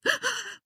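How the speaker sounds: sharp high gasp; a quick theatrical gasp; mock gasp. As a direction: gasp in disbelief. Reacting to friend's question.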